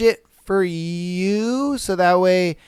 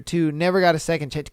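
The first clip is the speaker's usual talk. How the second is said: ordinary talk, nothing marked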